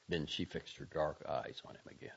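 ordinary delivery, nothing marked

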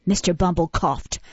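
usual speaking voice

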